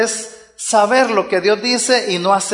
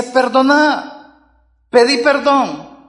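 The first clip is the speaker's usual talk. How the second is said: higher, slow, louder plea; high slow urgent plea; raised pleading command; 6 semitones higher, 4 dB louder, much slower than usual. Acted. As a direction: lift it as an urgent appeal. Preacher voicing God's plea.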